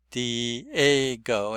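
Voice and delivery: "Diego" with the stress on the second vowel, dee-AY-go. Shown as a demonstration. Teacher explaining where the stress falls, saying Diego again. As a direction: lean on the AY in "Diego". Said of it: "the stress is on the A".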